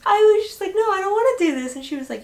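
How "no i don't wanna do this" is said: high, quick mock protest; retold refusal. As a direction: refuse like you're totally not having it, acted out. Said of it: "just, like, not having it".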